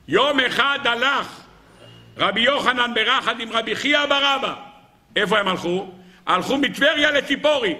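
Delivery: high-pitched, ringing story proclamation; impassioned preaching. Acted. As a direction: declaim the tale to the whole hall. Preacher tells midrash at full force.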